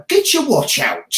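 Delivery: high shouted catchphrase. Shown as a demonstration. Host showing off his show catchphrase, high and punchy. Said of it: "different phrases that be catchy".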